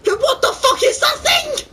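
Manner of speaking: very high-pitched real panic; horrified shriek. Real, not acted. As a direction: shriek it in horror at the monster. Horrified at monster on screen.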